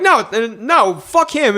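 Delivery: high-pitched, exasperated refusal; retold heated denial. Cook rejects coworker's [name] question, exasperated. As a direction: replay an indignant no, pitch jumping.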